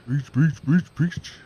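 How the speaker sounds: loud, high repeated blurts; rapid-fire correction. Real, not acted. Cutting in on a friend who cannot say that, loud and insistent. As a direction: bark it over and over to cut in.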